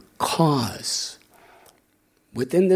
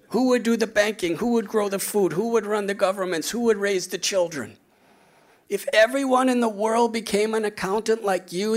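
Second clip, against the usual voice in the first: lightly amused, higher turned-around echo; gentle comeback; 6 semitones higher than usual. Retold or imitated. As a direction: turn his words back, amused. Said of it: "I said".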